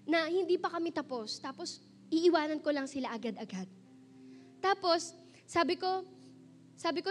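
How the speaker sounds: ordinary talk, nothing marked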